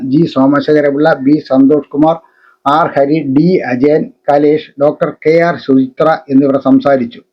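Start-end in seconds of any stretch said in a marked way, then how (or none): none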